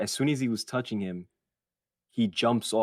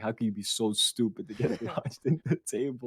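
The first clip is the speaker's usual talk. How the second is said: scolding parents; 2 semitones higher than usual. Retold or imitated. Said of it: "they were like".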